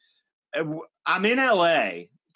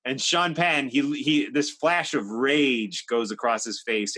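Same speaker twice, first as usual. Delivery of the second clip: ordinary talk, nothing marked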